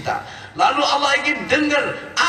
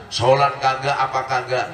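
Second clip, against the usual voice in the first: ordinary talk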